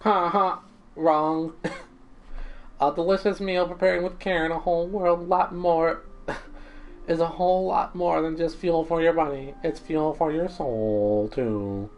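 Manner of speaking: a cheerful cooking robot, sure of himself; robot chef. Acted.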